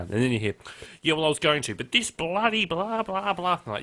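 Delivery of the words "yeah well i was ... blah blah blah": higher, slowed mock grumbling caller; lightly voiced whinging radio caller; rambling caller. A caller ranting off topic on talk radio, higher and slowed.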